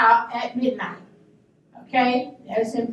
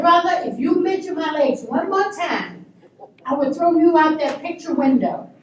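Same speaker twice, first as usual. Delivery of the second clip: loud, raised-pitch warning; retold threat, laid down firmly; 4 semitones higher, 3 dB louder than usual. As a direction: warn him off in a strong voice. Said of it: "I stood firm".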